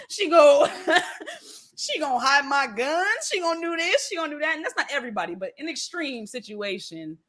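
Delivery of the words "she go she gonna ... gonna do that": high, worked-up mock insistence; boastful son. Son bragging what mama will do for him, mock insistent. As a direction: imitate a son proudly listing, pitched up.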